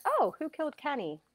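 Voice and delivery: high, puzzled echo of the question. Retold or imitated. Medium echoing a spirit's question, puzzled and high. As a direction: repeat it back, bemused and high-pitched.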